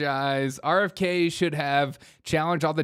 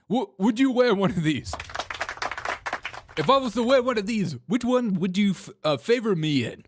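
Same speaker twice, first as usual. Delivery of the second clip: higher, pushy questioning; mock Sanders; 6 semitones higher, faster than usual. Host mimicking Sanders asking about onesies, higher and pushy. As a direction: needle him like a grumpy senator. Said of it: "Sanders, again".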